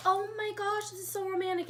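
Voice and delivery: high-pitched gush, re-enacting the moment; gushing excitement. Woman recalling seeing champagne glasses in the snow, gushing excitedly.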